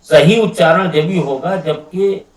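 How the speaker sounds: ordinary delivery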